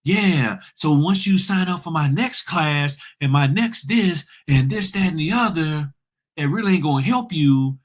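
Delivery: mocking sales pitch. Mocking the trainers who push classes to fund cruises, in a high smug voice. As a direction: play the greedy trainer selling his class. Mimicking a greedy notary trainer.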